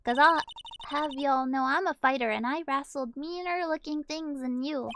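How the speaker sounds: lightly voiced southern woman, threatening; southern accent. Lottie warning them off a lawsuit, southern accent. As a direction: act tough in a southern accent. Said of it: "she is hard to do".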